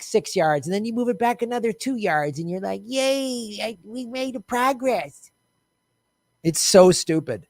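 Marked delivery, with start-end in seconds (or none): 2.9-5.3 small, soft, drawn-out yay; slow, sarcastic little cheer in a put-on voice; mock cheering. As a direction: celebrate feebly, making fun of them. Mocking celebration of tiny progress.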